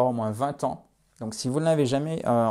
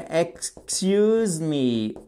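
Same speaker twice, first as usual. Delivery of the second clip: 'excuse me' stretched right out; very drawn-out, careful English; slow teaching model; much slower than usual. Shown as a demonstration. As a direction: model it syllable by syllable. Teacher models phrase slowly.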